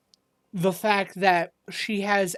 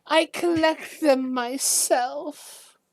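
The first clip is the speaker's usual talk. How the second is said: Olive Stillwater; 6 semitones higher than usual. Acted. Game master plays old shopkeeper.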